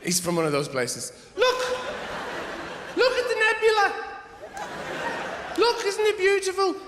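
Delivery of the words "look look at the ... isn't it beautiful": Brian Cox impression. Comic mocking Brian Cox showing the nebula: high awed gush. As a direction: gush over the nebula like a dreamy presenter. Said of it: "he would come on and go".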